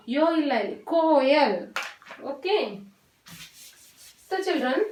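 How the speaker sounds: ordinary talk, nothing marked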